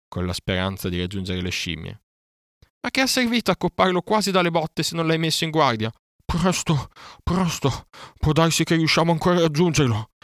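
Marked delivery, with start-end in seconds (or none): 2.8-5.9 loud, high-pitched reproach; angry scolding. A narrator's reading. Panther blames the bear for beating without warning, furious. 6.3-10.1 loud, high, urgent huffing; puffing. A narrator's reading. Bear urges on the chase after the monkeys, puffing and loud. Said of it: "sbuffò Baloo".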